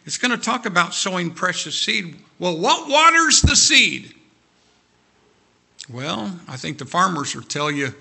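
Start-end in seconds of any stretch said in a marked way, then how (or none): 2.4-4.1 high, loud, slow rising cry; a preacher's searching wail; preaching cry. Acted. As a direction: lift it into a ringing call from the pulpit. Preacher's high rhetorical question.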